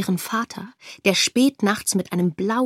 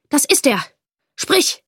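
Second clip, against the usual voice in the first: raised, louder accusing order; sharp command; 6 semitones higher, 4 dB louder than usual. A narrator's reading. Informer confronting the father, sharp command. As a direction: point him out, then demand an answer.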